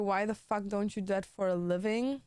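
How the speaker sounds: ordinary talk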